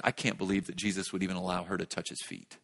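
low, hushed thought of a Pharisee; inner disbelief. Acted. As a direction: think it to yourself, low and soft. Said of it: "what's the Pharisee think?".

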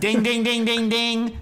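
excited right-answer bell; quiz bell imitation. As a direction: ring out like a game-show winner bell.

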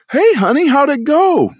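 high, bright and loud mom greeting; cheery mom greeting. Retold or imitated. Mom cheerfully greets her son home from practice, high and loud. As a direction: sing out hello as a chirpy mother.

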